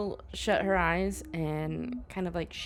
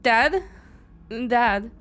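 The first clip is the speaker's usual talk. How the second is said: loud, higher calls for dad; calling out; 7 semitones higher, 8 dB louder than usual. Acted. Kaylin searches the forest for her father, loud anxious calls. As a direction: shout into the forest for him.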